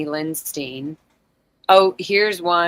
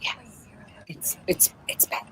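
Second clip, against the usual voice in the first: breathy protest whisper; 2 semitones higher, 14 dB quieter than usual. Pretend. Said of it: "I'm just gonna protest whispering now".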